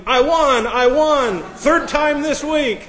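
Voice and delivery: high, loud, gleeful shriek; mock scream. Preacher acting out an ATM prank, screaming with joy. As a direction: scream it like you hit the jackpot.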